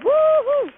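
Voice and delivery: high, loud celebratory woo-hoo; jubilant whoop. Retold or imitated. Woman applauding herself in her heart, jubilant loud whoop. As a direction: let out a soaring cheer. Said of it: "I was jubilant".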